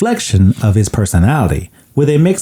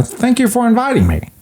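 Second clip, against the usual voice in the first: shy Grinch; 11 semitones higher than usual. Retold or imitated. S0 voices the Grinch.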